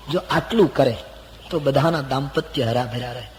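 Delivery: ordinary talk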